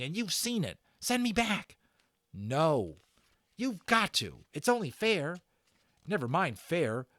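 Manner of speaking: high, pleading and insistent; heated boys' argument. A narrator's reading. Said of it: "Patrick and Omri arguing".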